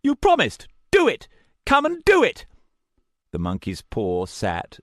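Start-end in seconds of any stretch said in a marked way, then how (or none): 0.0-2.5 high, loud, crazed accusation; snarling madman. A narrator's reading. Madman accusing the narrator: high furious snarl. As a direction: spit each word like a lunatic accusing.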